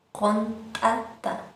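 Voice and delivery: contata slow, the ta popped hard; slow contata with an explosive ta. Shown as a demonstration. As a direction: burst the ta in contata, slowly. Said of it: "explosivo la ta".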